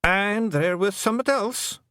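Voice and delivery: high Scots voice, only a touch under his breath; a hint of muttering; mild Feegle mutter. The chief muttering a reminder to his lads. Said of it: "muttered the twiddler".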